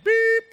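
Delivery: high, loud electronic beep; imitated beep. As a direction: squeal it like a phone alarm. Phone alarm sound imitated.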